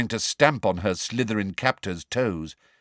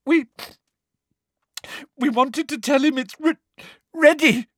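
high, loud, choking stammer; girl choking on fake tears; choked; 5 dB louder than usual. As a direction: choke out the word. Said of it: "choked Hermione".

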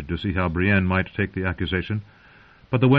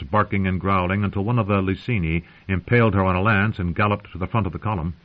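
plain reading voice

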